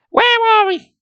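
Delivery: put-on very high girl's voice, choking out words; high frightened girl; choked out. A narrator's reading. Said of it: "Nicole choked out".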